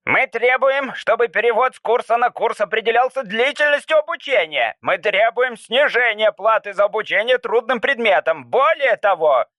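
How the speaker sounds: a protest leader shouting demands; rough nasal voice. Acted. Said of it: "Грубый гнусавый голос прервал его". (грубый гнусавый голос, требовательно)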